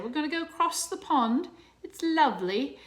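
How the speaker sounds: put-on teacher hams up a British accent for her class; mock British accent. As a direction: play at being a proper Englishwoman for the kids. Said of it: "She has that little British accent, lovely, with a spot of tea".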